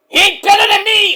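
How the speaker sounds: high, loud shout in Mike's voice; indignant outburst. Acted. Mike objecting to praise of a rival, indignant shout. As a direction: yell it as an offended wrestler.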